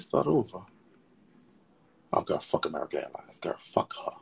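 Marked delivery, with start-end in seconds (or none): 3.4-4.1 whisper. Real, not acted. Angry at airline.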